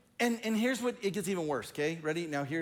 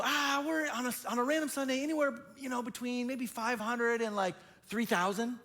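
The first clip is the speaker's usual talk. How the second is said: high, breezy, evasive range; answering other pastors to throw them off, high and breezy; vague breezy answer; 8 semitones higher than usual. Retold or imitated. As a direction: toss out the range lightly, high up. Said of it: "And I'll go".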